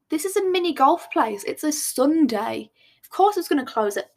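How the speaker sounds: usual speaking voice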